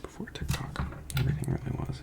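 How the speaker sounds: soft unvoiced aside; whispering. Real, not acted. Vlogger whispers an aside to viewers. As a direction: drop to a whisper.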